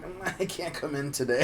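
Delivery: feeble fake-sick phone voice. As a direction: sound pathetically ill on the phone.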